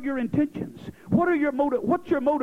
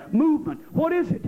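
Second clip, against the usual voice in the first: ordinary talk, nothing marked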